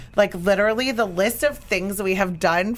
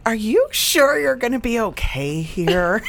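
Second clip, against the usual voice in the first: doubtful concern; 3 semitones higher than usual. Retold or imitated. Acts out doubting her introverted sister.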